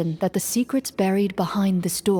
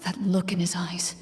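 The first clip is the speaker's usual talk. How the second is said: whispered aside; 5 dB quieter than usual. Acted. Muttered aside after the fight.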